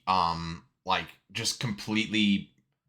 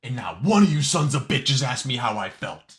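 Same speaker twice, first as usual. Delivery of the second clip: loud promo line; 3 semitones higher, 7 dB louder than usual. Retold or imitated. Quoting Cena's angry promo.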